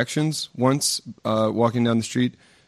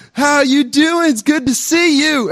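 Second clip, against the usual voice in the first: put-on loud, high hearty Southern good old boy; good old boy greeting; 5 dB louder than usual. Southern good old boy greeting a Parisian, loud and hearty. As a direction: boom it out like a friendly Southern Baptist. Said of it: "a good old Southern Baptist".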